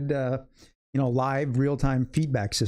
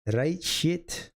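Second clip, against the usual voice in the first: mock Scottish accent, pitched higher; 5 semitones higher than usual. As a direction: toss off a hammed-up Scottish quip. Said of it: "We're speaking in different accents".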